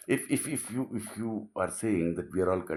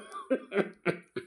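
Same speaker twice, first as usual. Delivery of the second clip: laughter; 11 semitones higher, 6 dB quieter than usual. Real, not acted. Laughs at his own question.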